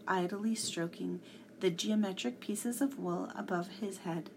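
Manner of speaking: ordinary delivery, nothing marked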